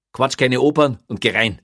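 impatient order. A narrator's reading. Policeman hurrying prisoner into the cell, impatient. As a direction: cut him off and order him in.

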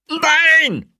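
long, very high, loud scream; panicked scream. A narrator's reading. Locked-in prisoner hammering on the door, panicked scream.

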